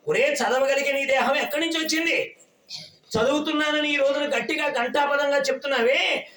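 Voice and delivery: ordinary talk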